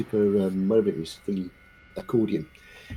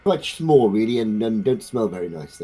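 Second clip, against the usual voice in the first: loud, higher mock insult easing off; shouted abuse; 4 semitones higher, 12 dB louder, slower than usual. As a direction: shout a silly insult, then tail off. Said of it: "you shout abuse and go".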